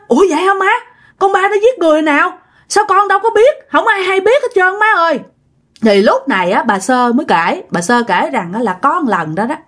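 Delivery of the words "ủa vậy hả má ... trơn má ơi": higher, incredulous questioning; disbelieving protest to mother, pitched high; astonished daughter. Acted. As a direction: voice jumps up in mild acted shock. Shocked daughter hears of a killing.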